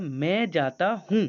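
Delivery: drawn-out correct form; slow corrected sentence. Shown as a demonstration. Teacher voicing the student's correction slowly. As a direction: say the right version slowly and clearly. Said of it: "तो तुमने कहा".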